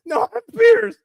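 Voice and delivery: very high, loud mock woman's voice; mocking her insistence. Mocking her insistence to the chat, very high and loud.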